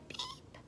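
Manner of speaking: tiny, very high soft peep; chick peep. A narrator's reading. Narrator voicing the hen's chick, tiny high peep. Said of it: "her chick said".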